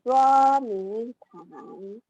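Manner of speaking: "Duo mi tang" very slow, syllable by syllable; teacher echoing "Duo mi tang" slowly; model tones. Shown as a demonstration. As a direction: stretch out "Duo mi tang" so students hear each tone.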